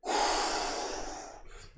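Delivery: whispered sighing breath out; breathy exhale. Shown as a demonstration. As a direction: blow the breath out audibly. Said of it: "Deep inhale".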